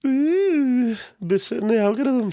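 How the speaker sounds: high, mocking parrot of his words; mocking mimicry. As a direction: mimic him back, way up high. Mocking friend's advice.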